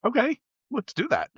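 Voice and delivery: high, eager yes; eager agreement. Retold or imitated. Answering a coworker's bar invite, eager and high. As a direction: jump at the invitation. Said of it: "I was like".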